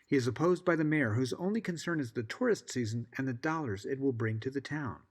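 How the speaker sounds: ordinary delivery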